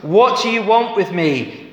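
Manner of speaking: hammed-up shout from the tombs; shouted demon's cry. A narrator's reading. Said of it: "He shouted at the top of his voice".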